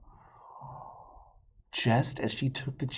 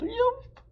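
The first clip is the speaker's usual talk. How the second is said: sharp high yelp; fox yelp; 4 dB louder than usual. Acted. Fox yelps flying up in the air, high yelp. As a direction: yelp in sudden pain. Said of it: "Fox yelled".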